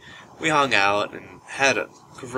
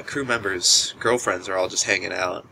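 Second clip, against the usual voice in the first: ordinary talk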